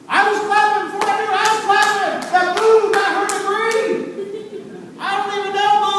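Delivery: high, loud, delighted exclaiming; excited. Real, not acted. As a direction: cheer along for a total stranger. Relives cheering for a stranger.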